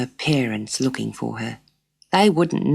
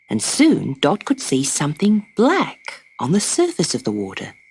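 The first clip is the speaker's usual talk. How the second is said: plain reading voice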